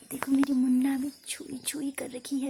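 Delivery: whisper. Real, not acted. Mother whispering beside her sleeping baby, a hushed whisper. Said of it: "तभी मैं आराम आराम से बोल रही हूँ".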